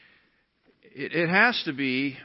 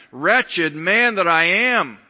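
anguished cry; 4 semitones higher, 8 dB louder, slower than usual. Acted. Paul's climactic cry quoted.

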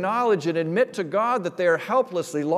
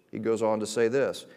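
usual speaking voice

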